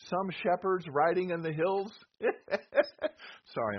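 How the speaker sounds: real laugh breaking out mid-line. Real, not acted. Preacher laughs at skeptics while teaching his class, laughing. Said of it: "I'm laughing at skeptics".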